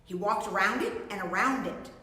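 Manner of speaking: plain reading voice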